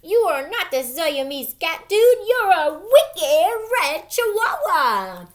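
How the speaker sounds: loud, high mock Spanish accent; hammed-up storybook Spanish accent, drawn out at the end. A narrator's reading. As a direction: put on a big Spanish accent and proclaim it. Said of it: "using his very best Spanish accent".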